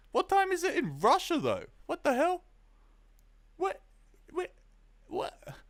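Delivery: can't believe what he just read; high incredulous outburst; shocked disbelief. Real, not acted. Chat says it's late in Russia.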